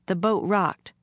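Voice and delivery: usual speaking voice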